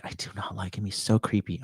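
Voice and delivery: disgusted hushed whisper. Retold or imitated. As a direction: whisper it with a shudder. Hushed, disgusted about him.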